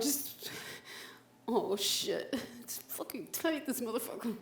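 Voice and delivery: very soft, strained cursing; strained hush. Acted. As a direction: curse under your breath while struggling. Struggling with tight rope, hushed.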